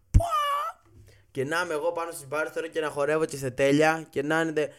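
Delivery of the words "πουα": high, loud whoop of glee; a joyful high whoop; triumphant whoop. Real, not acted. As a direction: shout for joy. Exclamation of triumph.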